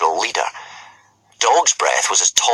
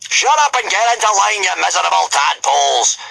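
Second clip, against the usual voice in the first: put-on high, loud bellow at the boys; yelling drill sergeant; 11 semitones higher, 3 dB louder than usual. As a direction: roar them into line like a drill master. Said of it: "yelled Gobber the Belch".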